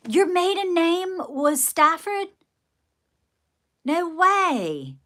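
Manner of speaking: high, drawn-out astonishment; amazed disbelief. Real, not acted. Vlogger learning a viewer's maiden name, amazed.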